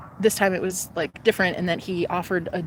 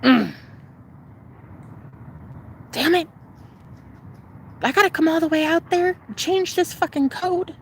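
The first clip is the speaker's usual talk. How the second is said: high, exasperated grumbling man; mock annoyed Willie; 9 semitones higher than usual. Willie complaining on the phone, mock annoyed. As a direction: play Willie fed up at being called out.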